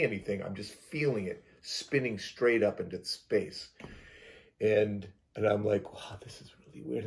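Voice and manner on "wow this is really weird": hushed, nearly whispered inner voice; soft, private musing; silent thought voiced. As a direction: murmur it as a thought to yourself. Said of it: "I'm not talking, I'm just thinking this".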